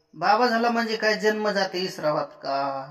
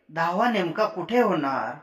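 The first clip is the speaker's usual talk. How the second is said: usual speaking voice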